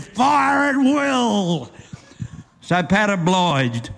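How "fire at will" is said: slow, drawn-out higher shout; swaggering drunk yelling a military order; commanding shout. Acted. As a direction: bellow it like a mock general. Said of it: "[name] commanded".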